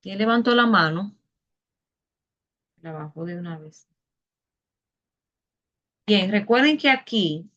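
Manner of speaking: ordinary delivery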